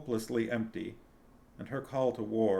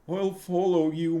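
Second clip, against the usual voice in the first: higher, louder, trembling declaration; quavering voice; 9 semitones higher, 7 dB louder than usual. A narrator's reading. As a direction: voice shaking with emotion. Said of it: "he declared in a quavering voice".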